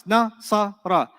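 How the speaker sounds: "Nasara" slowly split into three separate sounds; syllable by syllable. Shown as a demonstration. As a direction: break "Nasara" into na, sa, ra with gaps. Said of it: "separate sounds".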